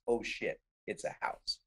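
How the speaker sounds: very soft, nearly whispered re-enactment; hushed film line. Retold or imitated. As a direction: drop right down for the quoted discovery. Quotes his authentic film line.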